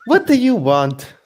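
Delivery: hammed-up boyish question; Naruto. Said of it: "Lafayette, you're Naruto".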